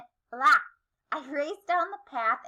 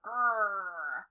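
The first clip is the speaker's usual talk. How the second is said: growl; 6 semitones lower, 4 dB quieter than usual. A narrator's reading. Growling creature sound.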